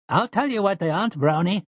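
put-on high, shrill old storekeeper voice; shrill shards-of-glass voice. A narrator's reading. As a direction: pitch it high and piercing like broken glass. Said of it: "in his shrill shards of glass voice".

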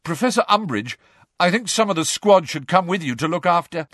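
high, keen volunteering; schoolboy angling to come along; eager. A narrator's reading. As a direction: push the suggestion eagerly. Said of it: "said Malfoy eagerly".

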